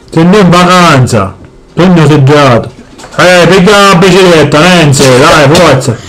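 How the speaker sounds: low, heavy father voice; gruff Sicilian dad. Acted. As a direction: boom it out like a grumpy papà. Plays the father.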